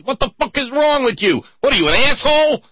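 high, loud mock angry cop; mock cop yell. Cop yelling at Bugs Bunny, high loud mock anger. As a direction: bark it like an outraged cop.

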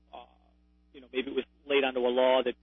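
normal talking voice

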